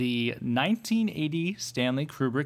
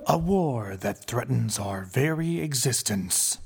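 movie trailer narrator; 5 semitones lower than usual. Acted. Trailer narration ending in whisper.

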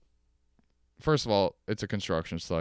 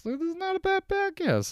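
much higher squeaky put-on voice. Joking about his own podcast, high squeaky voice. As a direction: pitch it up into a silly squeak.

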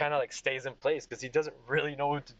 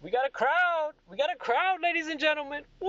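high whooping drunk playing to a crowd; Craig; 13 semitones higher, 4 dB louder than usual. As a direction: announce it gleefully, voice way up. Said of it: "he's having a freaking blast with himself".

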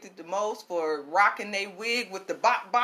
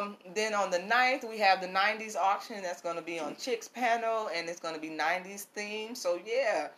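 ordinary talk

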